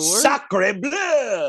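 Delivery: put-on French accent. Mock French exclamation.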